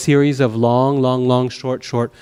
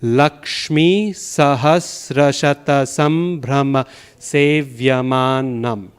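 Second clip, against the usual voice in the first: slow rhythmic Sanskrit verse line; metered recitation; slower than usual. Shown as a demonstration. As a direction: recite it slowly, leaning on the long syllables. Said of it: "if you were to do".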